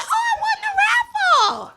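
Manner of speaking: high, slow, laughing squeal; excited laughing shout about a cheese prize; clueless excitement. Retold or imitated. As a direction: squeal over the cheese basket. Retold reply to PA.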